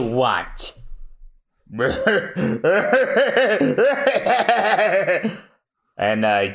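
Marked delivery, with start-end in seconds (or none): 1.7-5.3 big high mocking laugh; villain laugh. Pirate captain mocks the sailor.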